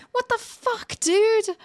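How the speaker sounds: high, sudden what the fuck; shocked outburst. Acted. Woman startled by stranger in graveyard, high alarmed cry. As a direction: jolt up in alarm at him.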